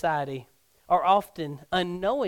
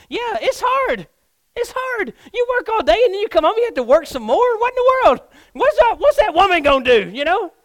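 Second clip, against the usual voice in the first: loud, high-pitched protest; indignant complaint; 13 semitones higher, 11 dB louder than usual. Acted. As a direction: whine loudly like an overworked husband. Acting out a worn-out husband's complaint.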